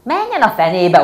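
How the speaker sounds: put-on loud furious child's yell. Girl yelling at Ilka néni in a rage. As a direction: scream it in a tantrum. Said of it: "ordítoztam".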